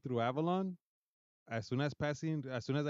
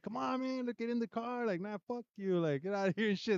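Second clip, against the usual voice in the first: much higher, pleading stranger voice; mock insistent stranger; 9 semitones higher than usual. A stranger pressing a kid to get in, high mock pleading. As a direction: beg him to get in the car, pitched way up.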